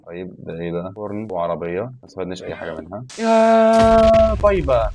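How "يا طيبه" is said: very slow, high, loud stretched cry; drawn-out mock sigh. Real, not acted. Gamer lamenting useless loot, drawn-out wail. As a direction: drag it out in mock despair.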